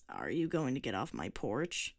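put-on low, grumbling old man voice; grumpy old man. A narrator's reading. Old man asking the girl to leave his porch, low and grumpy. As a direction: grumble at the pest.

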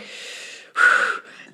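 long, audible sigh. Acted. Player acts rider reluctantly lifting gnome, sighing. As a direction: let out a big breathy sigh. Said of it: "She's like".

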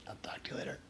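unvoiced whisper through a lost voice. Real, not acted. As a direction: whisper because the voice is gone. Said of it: "My voice is too messed up".

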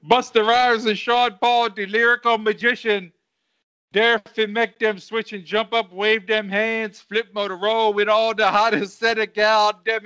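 rapped verse in a put-on Jamaican accent; rhythmic amateur Jamaican patois. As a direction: chant it with a mock Jamaican accent. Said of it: "Do I have to do a Jamaican accent?".

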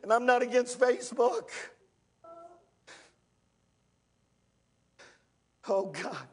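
rising, shouted preacher cadence; full-force pulpit preaching. Acted. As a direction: preach it hard to the congregation.

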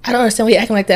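high-pitched imitation of her complaint; mimicked complaining woman.

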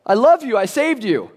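high, loud doting sister voice; older sister fussing over her rescued brother; imitating big sister. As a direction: gush like a caring big sister. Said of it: "if she says".

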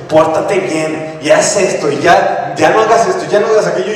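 mock parental orders, rising in pitch; scolding parent. Parents telling children to behave, imitated scolding.

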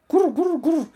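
high, loud pigeon coo. Acted. Pigeon answering.

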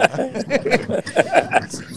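short high burst of laughter. Real, not acted. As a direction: crack up at your own story. Host laughs at own story.

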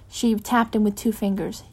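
ordinary delivery

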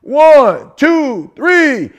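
each number called high and loud; referee count. Retold or imitated. Preaching to the crowd, ref counting the knockout, loud and high. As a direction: count him down like a ring ref.

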